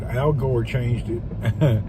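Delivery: slow dry deadpan; slow deadpan joke. Real, not acted. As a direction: drag it out deadpan.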